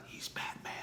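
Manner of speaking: hushed secret whisper. Retold or imitated. Speaker telling the mother her son is Batman, whispered. Punchline to the crying mom.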